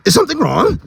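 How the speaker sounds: high squeaky monkey voice. Acted. Monkey voice in story.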